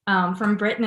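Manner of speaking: ordinary talk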